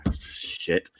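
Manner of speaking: hushed dismay. Pretend. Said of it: "everyone's like".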